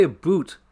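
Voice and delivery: "a boot" with an oo vowel, rhyming with shoot. Shown as a demonstration. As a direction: say "a boot" with a long oo. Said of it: "that Canadian people say that".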